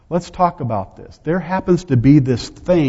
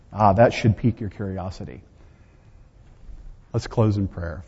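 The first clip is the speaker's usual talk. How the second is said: normal talking voice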